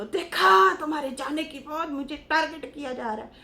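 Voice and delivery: mock a wife's aggrieved outcry to her husband; hammed-up wifely complaint; complaining wife. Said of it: "बीवी को बोलो".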